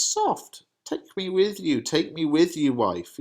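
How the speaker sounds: high startled father, slowing the repeat; Capulet's surprise. Acted. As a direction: hold on, father demanding an explanation. Reads Capulet's lines.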